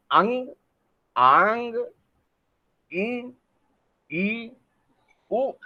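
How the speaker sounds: each vowel said alone through the nose; nasalized vowels demo. Shown as a demonstration. Teacher showing nasal vowels in a row. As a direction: let the sounds ring in the nose. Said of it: "ये सारे पे क्या जा रहा है".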